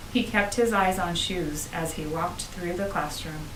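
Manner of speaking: usual speaking voice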